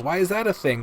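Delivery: normal talking voice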